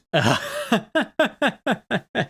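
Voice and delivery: high staccato cackle; long burst of high, choppy laughter; laughing. Real, not acted. Streamer to viewers about broken audio, laughing.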